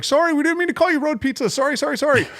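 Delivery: high, fast panicked sorry; mock frightened squeak; scared kid apology. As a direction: plead like a frightened little kid. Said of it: "And I was like".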